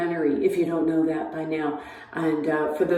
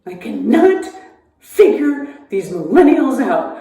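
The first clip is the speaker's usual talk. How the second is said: exasperated rant; 5 semitones higher, 6 dB louder than usual. Real, not acted.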